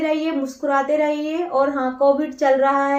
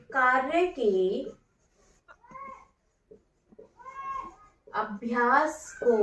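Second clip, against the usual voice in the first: word by word, quieter, long pauses between words; 4 dB quieter than usual. Shown as a demonstration. Teacher dictates notes word by word.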